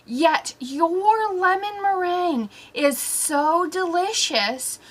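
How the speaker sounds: ordinary delivery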